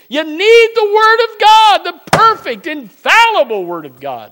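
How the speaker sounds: loud, high, rising pulpit preaching; preaching at full force. Acted. Pastor stirring the congregation about the word, preaching hard. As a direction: drive the word of God home to the pews. Preaches on being born again.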